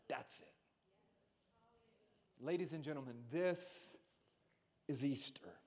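quiet end of the sermon; hushed close. Acted. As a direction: bring it down to a hush.

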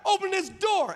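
loud, high, drawn-out shout; shouted demand. Acted. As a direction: holler through a closed door. Acted demand at a closed door.

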